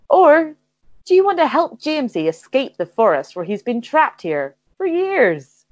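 ordinary delivery

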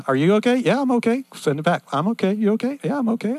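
high little machine voices trading check-ins; talking modems. Retold or imitated. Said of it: "they say".

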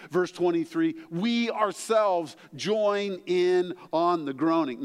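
impassioned sermon delivery; preaching. Acted. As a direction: preach it out, building the point. Pastor preaching on groanings.

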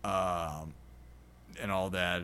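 ordinary talk, nothing marked

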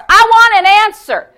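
high, loud bold demand; forceful, insistent cry; full force. Retold or imitated. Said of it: "He went full force".

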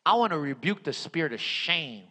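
low, subdued voice with long pauses; altar-call prayer. Real, not acted. Preacher prays for somebody wrestling with shame, altar-call prayer. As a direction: lead bowed heads gently into prayer. Leads closing prayer time.

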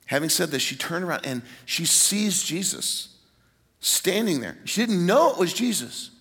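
ordinary talk

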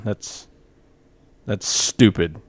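usual speaking voice

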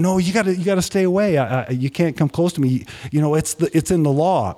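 acting out keeping someone at a distance; mildly alarmed leper. Leper keeps Jesus away.

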